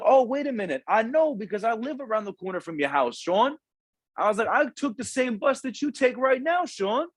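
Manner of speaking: high, amused gotcha voice, lifted well up; spring the trap on him with glee, pitched high; retold gotcha. Mentor busting the student's excuse in front of the counselor, high gleeful gotcha. Catching the kid's lie.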